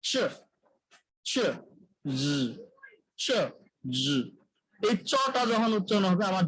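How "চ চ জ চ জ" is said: চ said unvoiced, জ with voice, alternating in isolation; paired sound demo. Shown as a demonstration. Teacher drilling the চ and জ pair for students. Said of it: "আমাদের আরো দুটো pair হচ্ছে".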